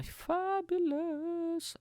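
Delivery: very high, long-drawn word; camp flourish. Pretend. Comedian showing off his outfits, high drawn-out camp flourish. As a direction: stretch it out extravagantly.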